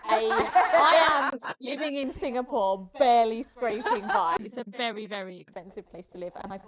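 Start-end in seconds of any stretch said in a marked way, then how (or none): 0.0-4.4 laughing through the words, slow and loud. Real, not acted. Laughing while joking about scraping by in Singapore. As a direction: chuckle it out as a self-mocking joke.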